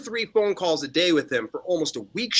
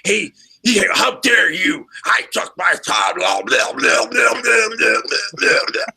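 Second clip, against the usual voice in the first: mock angry rant; 4 semitones higher, slower than usual. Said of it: "He's just like".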